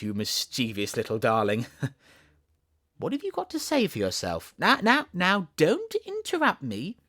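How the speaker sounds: Alice mock scolding. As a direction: pretend to be angry with the kitten.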